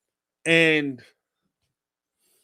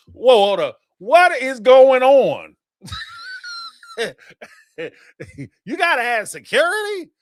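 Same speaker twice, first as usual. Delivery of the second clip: mock alarm; 8 semitones higher, 7 dB louder than usual. Husband hearing security is needed, loud stunned disbelief. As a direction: stop everything, stunned.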